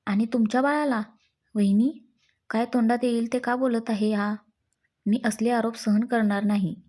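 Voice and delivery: usual speaking voice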